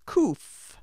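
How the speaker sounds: Kuf with a hard throaty k; Hebrew letter Kuf. Shown as a demonstration. Teacher modelling Kuf for learners to repeat. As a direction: say Kuf slowly for the class to echo. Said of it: "Repeat after me".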